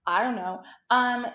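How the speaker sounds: ordinary talk, nothing marked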